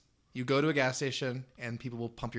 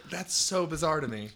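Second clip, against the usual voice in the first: ordinary talk, nothing marked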